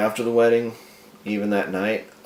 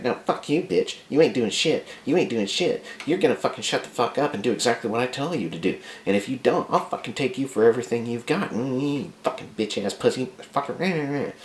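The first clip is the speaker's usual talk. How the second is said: higher-pitched imitation of an angry wife; wife's mock tirade; 6 semitones higher than usual. Wife ordering husband about, mocked angry nagging. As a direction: rant at him in a raised voice, sliding into whiny yammer. Wife's furious comeback, acted.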